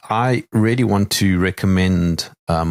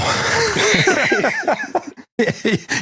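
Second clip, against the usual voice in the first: high burst of laughter. Real, not acted. Derailed outro, bursting out.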